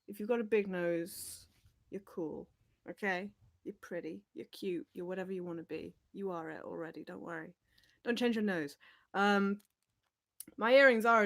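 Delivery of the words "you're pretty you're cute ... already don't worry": normal talking voice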